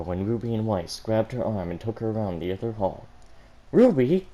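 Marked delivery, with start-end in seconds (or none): 3.7-4.3 calling out. Acted. Calling out sister.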